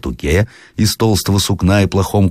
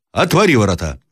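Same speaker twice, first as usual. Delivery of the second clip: raised-pitch demand to open up; calling out; 6 semitones higher than usual. A narrator's reading. Traveller wants the gate opened, calling out. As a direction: call it towards the window.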